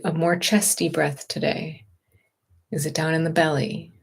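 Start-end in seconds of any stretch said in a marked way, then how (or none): none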